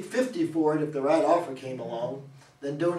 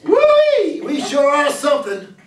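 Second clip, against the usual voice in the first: high, loud whoop of joy; jubilant whee; 7 semitones higher, 9 dB louder than usual. Acted. Rusty throwing his Stetson in the air, jubilant loud whoop. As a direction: celebrate like you just struck gold. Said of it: "in jubilation".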